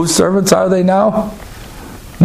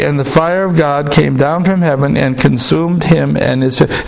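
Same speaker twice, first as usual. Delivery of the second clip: ordinary delivery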